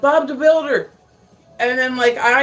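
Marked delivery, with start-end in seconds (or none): 0.0-0.9 loud, high blurted yell; retold yell. Blurting at a friend on the couch, sudden loud yell. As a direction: shout it suddenly, straight out of sleep. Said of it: "and I yelled".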